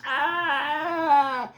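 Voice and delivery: long, high, loud wail; drawn-out frightened "Ah!"; panicked scream. Acted. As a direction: shriek in horror. Character screams at touching eyeballs.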